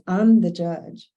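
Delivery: slow, loud, commanding retort; stern judge. Retold or imitated. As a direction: lay down the law as the judge. Judge barks order on phone.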